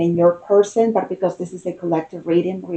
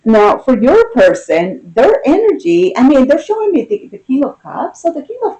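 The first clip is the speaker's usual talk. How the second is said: excited; 6 semitones higher, 5 dB louder than usual. Real, not acted. Excited after laughing.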